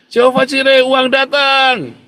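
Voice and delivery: high, loud, slow drawn-out cry; loud shout. Retold or imitated. Father showing his daughter how to shout it, loud shout. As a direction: belt out the faith cry at full voice. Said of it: "sekarang teriak Jehovah Jireh yang keras".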